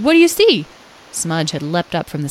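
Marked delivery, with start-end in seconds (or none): 0.0-0.7 high, loud, eager question; alert, high-pitched character voice; interrupting. A narrator's reading. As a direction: call out, urgently curious. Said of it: "Lyle interrupted".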